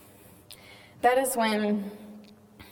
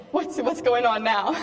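laughing through it; 4 semitones higher than usual. Retold or imitated. Retelling her laughing fit during prayer, giggling through the words. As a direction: quote broken up by real giggles. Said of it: "Everybody started to look at me".

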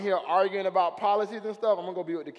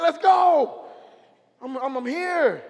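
eager shout; 9 semitones higher, 10 dB louder, slower than usual. Acted. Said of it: "He's like".

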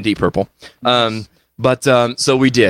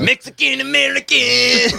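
put-on voice; 14 semitones higher than usual.

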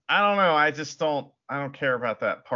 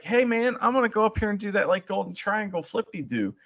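casual wrestler voice; 7 semitones higher than usual. Retold or imitated. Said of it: "And then Ibushi's like".

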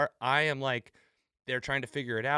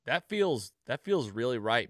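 ordinary talk, nothing marked

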